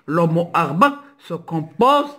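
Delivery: ordinary talk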